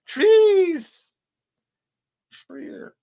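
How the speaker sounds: Trees. squealed very high and loud; Trees. in a sudden high squeak; squeaky high. Shown as a demonstration. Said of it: "Say cheers".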